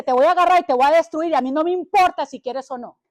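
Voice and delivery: a hint of menacing Satan, quick and loud; preacher lightly playing Satan's threat. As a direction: voice the devil lightly, pushing fast. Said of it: "Satanás dice".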